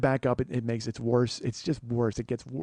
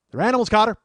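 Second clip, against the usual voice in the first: loud, higher, fed-up yell; angry shout; 6 semitones higher, 10 dB louder, faster than usual. Real, not acted. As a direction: bark the verdict out. Angry rant continues.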